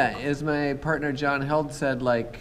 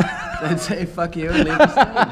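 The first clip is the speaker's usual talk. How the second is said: laughing; 8 semitones higher, 8 dB louder, slower than usual. Pretend. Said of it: "imagined reply to hecklers".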